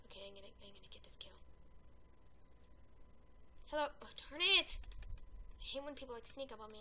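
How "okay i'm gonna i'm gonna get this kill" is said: sneaky whisper. Real, not acted. Sneaking up on an enemy.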